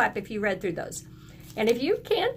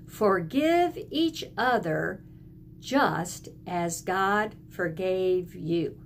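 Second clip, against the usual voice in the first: put-on slowly, word by word, for children to follow; a teacher reciting a Bible verse with pupils; slow choral recitation; 2 semitones lower, much slower than usual. Shown as a demonstration. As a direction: lead the class through the verse slowly. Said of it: "can you say that all together".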